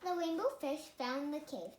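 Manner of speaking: ordinary delivery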